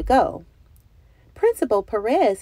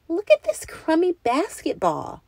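complaining; 10 semitones higher than usual. A narrator's reading. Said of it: "Clara complained".

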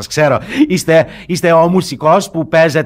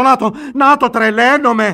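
frantic; 9 semitones higher, 5 dB louder than usual. Acted. Father losing his mind.

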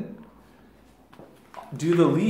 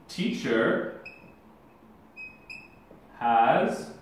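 slow dictation; 6 semitones lower, 4 dB quieter, slower than usual. Shown as a demonstration. Dictating while writing.

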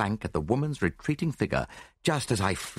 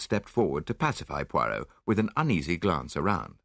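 usual speaking voice

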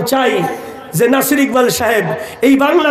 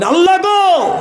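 high, shouted cry to God; enraged plea; 9 semitones higher than usual. Acted. Enraged prophet begging Allah to destroy them, high angry shout. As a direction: call down destruction in an acted fury. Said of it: "উনি রাগান্বিত হয়ে বললেন".

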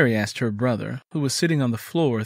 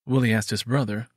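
ordinary delivery, nothing marked

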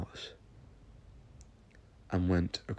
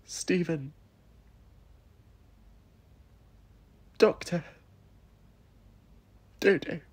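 high, tearful voice close to crying; choking back tears as she says it; tearful pleading; 9 semitones higher, 3 dB louder than usual. Acted. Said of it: "I began to cry".

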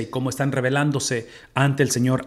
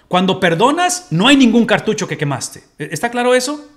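louder, higher, slowed declaration; emphatic preaching; 8 semitones higher, 6 dB louder than usual. Real, not acted. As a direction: drive the point home with force. Predicando con fuerza. (predicando con fuerza, más alto)

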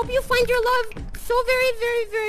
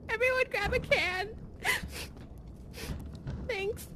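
tearful; 11 dB quieter than usual. Pretend. Crying over sad wet food.

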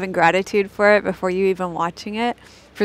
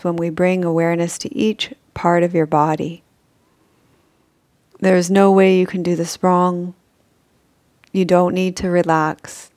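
guided meditation; 3 dB louder than usual. Acted. Guide leading listeners through a gratitude sleep meditation, calm and measured. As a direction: guide them toward sleep in even, unhurried phrases.

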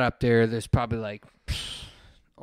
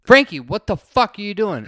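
yelling; 7 semitones higher, 9 dB louder than usual. Retold or imitated. Friends yelling at Frankie.

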